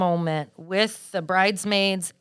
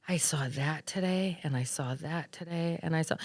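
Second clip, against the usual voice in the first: much softer, marvelling to herself at midnight; dreamy hush; 2 semitones lower, 11 dB quieter than usual. Retold or imitated. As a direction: murmur it to yourself, still amazed. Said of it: "I'm like".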